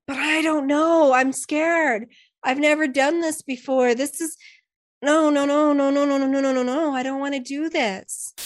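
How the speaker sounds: put-on a scared child refusing to fall; frightened leaf. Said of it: "The leaf started to have some fear again".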